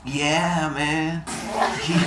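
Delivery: laid-back hippie drawl; lightly voiced spaced-out hippie. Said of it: "my hippie voice with my voice the way it is".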